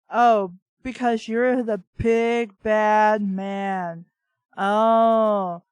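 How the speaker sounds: slow, loud sneering mock; sarcastic mockery. Real, not acted. Reader mocking the hero's big bad man act, sarcastic. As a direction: drag it out scornfully.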